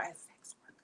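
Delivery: hushed, secretive aside; whispered warning. Real, not acted. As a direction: lean in and whisper it. Warning aside mid tarot reading.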